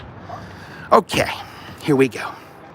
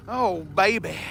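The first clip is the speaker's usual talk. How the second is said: normal talking voice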